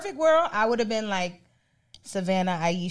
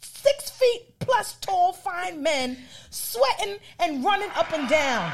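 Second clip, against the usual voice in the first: higher, slow and gushing, almost a shout; excited gushing; 10 semitones higher, 3 dB louder, slower than usual. Acted. Gushing to people who ask why she watches basketball, higher and slower. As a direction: swoon over the tall athletes out loud. Hyped answer to people's question.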